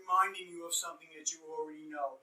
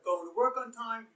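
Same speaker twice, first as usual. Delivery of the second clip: ordinary talk, nothing marked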